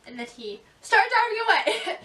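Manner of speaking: laughing through the words. Real, not acted. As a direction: laugh it out while telling. Laughing while retelling.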